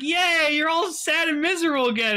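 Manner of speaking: high, cheery teasing voice; mock cheery Kaito. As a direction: sing it out like a goofy friend. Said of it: "He's like".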